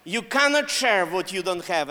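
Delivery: ordinary talk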